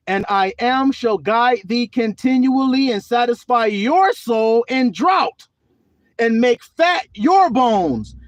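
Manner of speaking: loud, slow, proclaiming each line; preached scripture. Acted. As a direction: read the verse like a proclamation.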